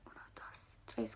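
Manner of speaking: whispered counting to oneself; under the breath. Real, not acted. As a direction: count under your breath, barely audible, searching for a word. Counting under breath to recall word.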